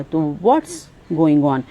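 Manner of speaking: ordinary delivery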